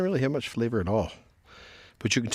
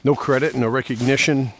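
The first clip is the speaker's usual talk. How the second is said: earnest musing voice-over; reflective monologue. Acted. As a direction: ponder aloud, earnestly. Reflective monologue while mowing.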